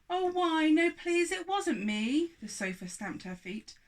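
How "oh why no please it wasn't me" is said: high, loud pleading, slowing at the end; frightened plea. Acted. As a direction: beg not to be sent away. Sofa pleads.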